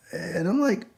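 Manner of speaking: ordinary delivery, nothing marked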